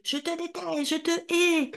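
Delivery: high, tearful screaming; child screaming hatred at the mother; hateful shout. Retold or imitated. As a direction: scream the hate out. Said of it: "Et je criais".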